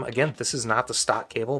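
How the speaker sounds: normal talking voice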